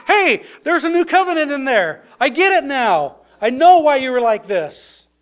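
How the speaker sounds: high, bright, eager rush of discovery; a younger brother bursting with the news; excited little brother. Retold or imitated. Brother voiced, excited realization.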